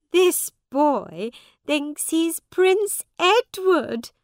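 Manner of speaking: high, loud, slow mockery; mocking. A narrator's reading. Woman to her friends about the boy, mocking. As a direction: announce it to ridicule him.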